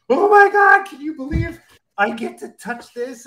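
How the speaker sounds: geeking out. Retold or imitated. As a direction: squeal with disbelief at getting to touch the set. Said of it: "I am geeking out".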